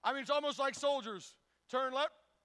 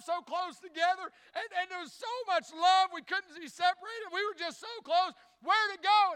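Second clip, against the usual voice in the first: higher, louder mock wife, pleading for lost closeness; 8 semitones higher, 8 dB louder than usual. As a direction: play the hurt wife, hammed up and longing. Wife voiced in farmer joke.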